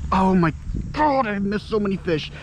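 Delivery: usual speaking voice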